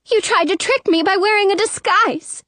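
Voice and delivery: high, near-tearful accusation; frightened, voice catching. A narrator's reading. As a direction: accuse as if about to cry. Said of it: "Her voice catches as if she's frightened".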